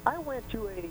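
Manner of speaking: faint, distant voice off the phone; phone held away. Real, not acted. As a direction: caller too far from the receiver. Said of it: "could you speak into the phone better".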